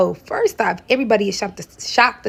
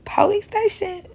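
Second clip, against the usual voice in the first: incredulous whisper; 11 semitones higher, slower than usual. Real, not acted. Incredulous repeat of request.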